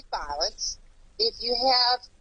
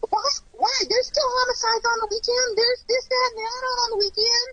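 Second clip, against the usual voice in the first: high, insistent protest; higher-pitched, incredulous challenge; indignant questioning; 10 semitones higher than usual. Real, not acted. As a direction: push back in exasperated disbelief.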